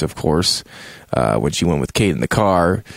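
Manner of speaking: ordinary talk, nothing marked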